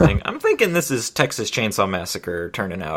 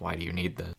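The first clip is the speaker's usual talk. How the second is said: very soft, puzzled aside; unimpressed; 3 semitones lower, 14 dB quieter, faster than usual. Acted. Farm-raised Rayo questions fancy stuff, softly unimpressed. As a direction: murmur it, not getting the fuss. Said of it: "so he's just like".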